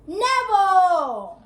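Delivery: loud, long, drawn-out call in one voice; one big unified shout of the name; shouting together. A narrator's reading. Children call in unison, long loud shout. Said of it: "They all shouted together".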